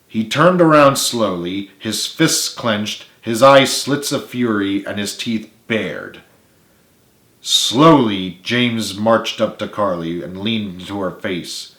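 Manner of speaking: ordinary delivery, nothing marked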